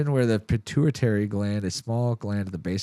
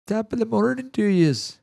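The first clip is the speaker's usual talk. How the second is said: high, hammed-up Irish brogue; mock Irish accent; 12 semitones higher than usual. Mock Irish brogue to his friends about clan peace. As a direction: play a cheery stage Irishman.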